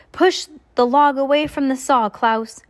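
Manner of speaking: urgent, high, loud order; shouted command. A narrator's reading. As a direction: call it out to stop a disaster. Violet's shouted command to brother.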